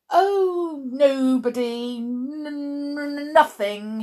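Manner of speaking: high, loud, drawn-out stutter; flustered stammer. A narrator's reading. Mister Stink hiding who is in the photo, stammering. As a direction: stammer and stick on the first sound. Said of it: "he stammered".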